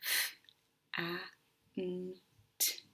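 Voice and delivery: "F, A, N, T" as four isolated phonics sounds; "F, A, N, T" segmented slowly, short "a" as in ant; sounded out. Shown as a demonstration. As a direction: say "F, A, N, T" one sound at a time with pauses, not letter names. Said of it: "What sounds do you hear in fant".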